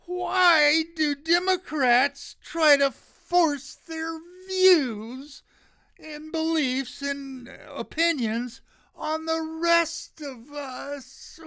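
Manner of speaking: high, slow whiny mock whine. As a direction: whine it like a spoiled complainer. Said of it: "I'm gonna use my whiny voice for this one".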